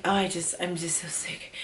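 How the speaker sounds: faint whisper, feeling ill; sick whisper. Retold or imitated. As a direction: whisper it weakly, too sick to talk. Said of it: "I'm like".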